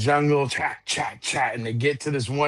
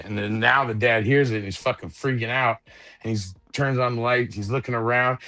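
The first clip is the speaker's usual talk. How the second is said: ordinary delivery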